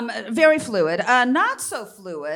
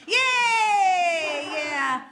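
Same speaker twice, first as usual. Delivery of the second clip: high, bright fake cheer; hammed-up celebration shout; mock cheer; 5 semitones higher than usual. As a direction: cheer it like a party.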